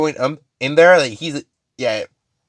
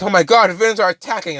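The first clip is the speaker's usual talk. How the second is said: higher-pitched mock panic; alarmed; 8 semitones higher than usual. Mineta reacting to villains attacking, alarmed. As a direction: raise the alarm in a mild panic.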